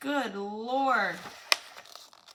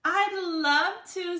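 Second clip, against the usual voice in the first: high, loud delighted answer; 8 semitones higher, 5 dB louder than usual. Acted. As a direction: accept eagerly. Arthur offered a puppy.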